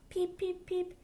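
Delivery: high squeaky little duckling peeps; baby duck peeping. Acted. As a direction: chirp it out high like a tiny duck. Baby duck's peeps in story.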